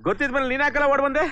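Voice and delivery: high-pitched, exasperated demand; exasperated scolding. Acted. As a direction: scold your companion for running off. Scolds companion for running away.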